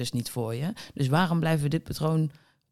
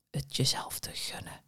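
soft whispered aside; 11 dB quieter than usual. Real, not acted. Coach telling the viewer to treat herself, whispered. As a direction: whisper it like a secret permission. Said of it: "ik ga nu fluisteren".

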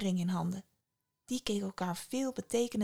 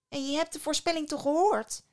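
high-pitched, insistent cry; alarmed older sister, pitched up; exclaimed reproach; 7 semitones higher than usual. A narrator's reading. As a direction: call it out as an urgent reminder. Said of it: "riep haar oudste zus uit".

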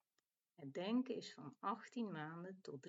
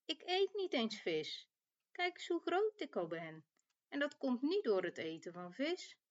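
higher, emphatic lamb voice; defiant little lamb; 6 semitones higher, 4 dB louder than usual. A narrator's reading. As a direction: protest like a stubborn little lamb. Lamb answers mother sheep in story.

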